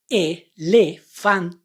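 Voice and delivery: "Elefante" drawn out very slowly, vowel by vowel; very slow reading. Shown as a demonstration. Teacher drawing out "Elefante" very slowly. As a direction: stretch "Elefante" syllable by syllable. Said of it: "Now let's read this word".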